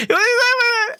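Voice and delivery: laughing. Real, not acted. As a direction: burst out laughing while repeating it.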